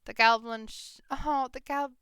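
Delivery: normal talking voice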